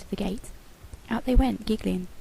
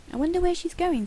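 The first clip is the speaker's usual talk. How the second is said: put-on curious girl voice; 9 semitones higher than usual. A narrator's reading. Amy's voice in story.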